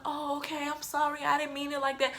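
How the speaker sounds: high, soft, meek mimicry; small, squeaky fake sorry; mock apology. Supervisor's mocked apology to grandma.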